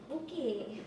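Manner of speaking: lightly voiced accented dad, curt; a hint of an unimpressed father. Dad's cool reply repeated.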